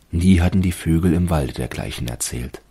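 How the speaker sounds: ordinary delivery, nothing marked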